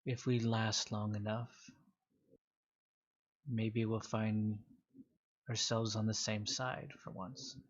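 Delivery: slow, measured words to a rival; confiding. Acted. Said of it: "he kinda leans into you".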